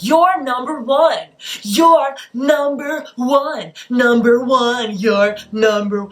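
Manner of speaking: high, loud, stretched-out hype voice; weird obnoxious voice. Retold or imitated. Mimicking her date hyping the waiter, obnoxiously. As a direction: drag out "number one" in a goofy hype voice. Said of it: "this weird, obnoxious voice".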